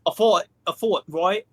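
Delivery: mock British accent, tagging yeah; put-on British accent. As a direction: play a cheeky cockney. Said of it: "Why did you say man like that?".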